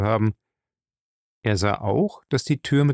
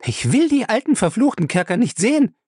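high, loud frightened protest; cries out; 12 semitones higher, 4 dB louder than usual. Acted. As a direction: she flatly refuses, calling out high and loud. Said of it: "rief sie".

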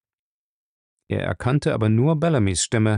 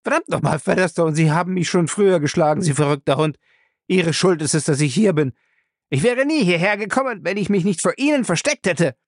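ranting; 7 semitones higher than usual. Acted. Madman rants at captor.

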